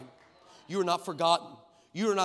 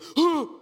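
sudden loud gasp of surprise; sharp gasp; 9 semitones higher, 9 dB louder than usual. Retold or imitated. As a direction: gasp in sharply like the woman did. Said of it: "all of a sudden this woman goes".

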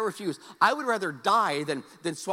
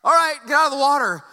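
loud call from the pool edge, higher; instructor's call; 8 semitones higher, 13 dB louder than usual. Retold or imitated. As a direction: bark the order across the pool. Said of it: "I hear this whistle".

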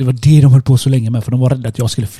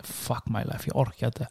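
much softer, low, worn-out sigh of words; defeated murmur; 3 semitones lower, 16 dB quieter than usual. Retold or imitated. Inner thought after being told the tyre is ruined, murmured. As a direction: drop it almost under the breath, giving up.

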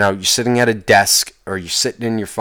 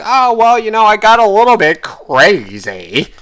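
mocking impression; 10 semitones higher, 6 dB louder than usual. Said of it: "just be like".

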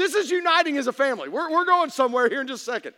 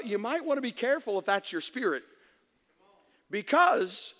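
ordinary talk, nothing marked

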